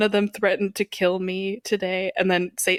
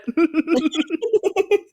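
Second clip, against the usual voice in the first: high loud laughter; 9 semitones higher, 4 dB louder than usual. Real, not acted. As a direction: crack up loudly. Laughing at own joke.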